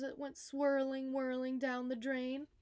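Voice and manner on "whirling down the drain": plain reading voice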